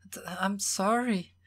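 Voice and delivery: stammered, high, breathy sobbing apology; tearful gasp. A narrator's reading. Evelyn apologises to Will while crying, tearful gasping. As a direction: choke the words out slowly through tears. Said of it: "finally managed to grasp".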